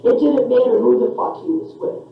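ordinary talk, nothing marked

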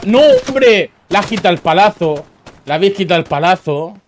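high, loud indignant protest; outraged cry. Real, not acted. Streamer protesting a stolen kill.

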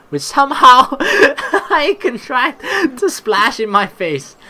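high, loud, broken by laughter; slow read through real laughter; laughing while reading. Real, not acted. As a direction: crack up mid-sentence. Cracks up reading the game text.

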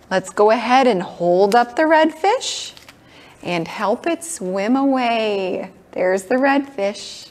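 bright, sing-song preschool teacher; kids show host. Acted. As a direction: coax little ones along gently. Leads toddlers in a kids' class.

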